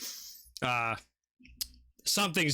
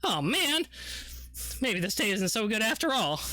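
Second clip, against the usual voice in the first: higher, rueful lament; dismayed NPC; 7 semitones higher than usual. Acted. Bystander watching the bloodshed, higher rueful lament. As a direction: sigh it out like a doomed bystander.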